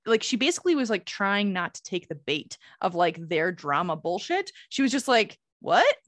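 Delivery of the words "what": higher, louder mock-baffled question; imitated bafflement. Retelling a friend's reply to rude remarks, mock baffled. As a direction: act out puzzled disbelief, voice rising.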